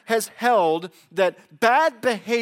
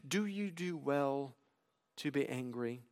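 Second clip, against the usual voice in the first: 1 God's quiet question; 5 semitones lower, 12 dB quieter than usual. Acted. God's reply, read softly.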